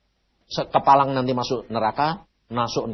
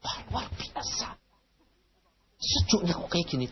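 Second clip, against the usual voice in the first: awed whisper; 5 semitones higher, 8 dB quieter than usual. Retold or imitated. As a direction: breathe it out in amazement. Preacher retells wonder at heaven's coolness.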